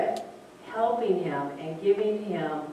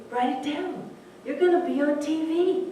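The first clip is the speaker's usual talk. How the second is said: higher, urging inner voice; a soul's encouraging voice, pitched up; 7 semitones higher than usual. Retold or imitated. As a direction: speak as the boy's soul nudging him forward. Soul speaking to the boy.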